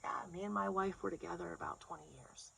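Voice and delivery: very soft, lightly voiced shy guy; man from group. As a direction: confide it under your breath. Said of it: "it's one of the guys".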